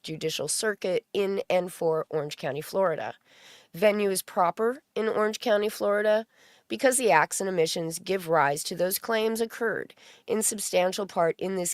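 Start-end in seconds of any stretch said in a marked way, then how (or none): none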